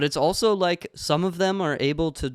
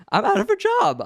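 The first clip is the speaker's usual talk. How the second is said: high, loud mock despair; 5 dB louder than usual. Real, not acted. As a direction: wail it in fake panic. Joking about losing job.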